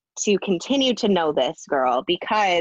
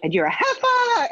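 mocking imitation of Brandi. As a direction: ham up Brandi's sassy delivery. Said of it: "She was like".

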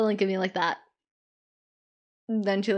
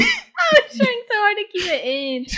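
laughing; 10 semitones higher, 4 dB louder than usual. Real, not acted. Cohost still laughing.

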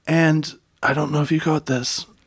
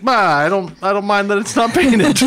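put-on whiny teen Wally; 7 semitones higher, 5 dB louder than usual. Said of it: "And he's like".

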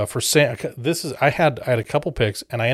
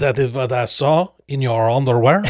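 laughing mock French; 6 dB louder than usual. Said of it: "outrageous French accent".

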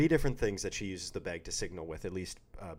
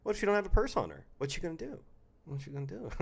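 dramatic rhetorical question; 8 semitones higher, faster than usual. Acted.

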